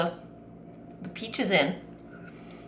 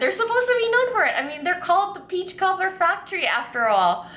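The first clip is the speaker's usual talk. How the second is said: bright and eager, pitched way up; excited; 9 semitones higher, 3 dB louder than usual. Real, not acted. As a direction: gush about it, higher and louder. Excited about famous cobbler.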